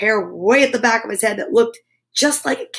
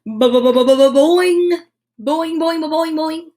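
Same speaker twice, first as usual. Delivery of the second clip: bouncy springing boings, louder; boing sound effect; 4 semitones higher, 3 dB louder than usual. A narrator's reading. Narrator making the hair go boing.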